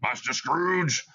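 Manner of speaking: slow, lightly voiced stern master; stern schoolmaster. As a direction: call him out slowly. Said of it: "bursts with it the schoolmaster".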